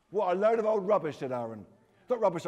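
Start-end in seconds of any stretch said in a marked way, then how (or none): 0.1-1.2 higher, much louder scoff; loud mockery. A narrator's reading. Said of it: "loud, mocking laughter". 2.0-2.5 loud, higher-pitched protest; fierce reply. A narrator's reading. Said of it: "I replied fiercely".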